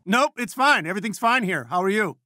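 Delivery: high-pitched, flustered cover-up; flustered reassurance. Acted. Telling the caller everything's fine here, flustered.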